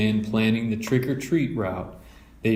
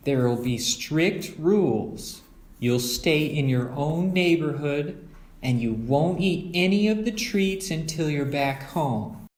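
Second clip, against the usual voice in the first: Mama laying down rules; 6 semitones higher than usual. Acted. Mother giving the cubs their Halloween rules, firm. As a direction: set the rules kindly but firmly.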